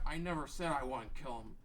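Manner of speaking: ordinary talk, nothing marked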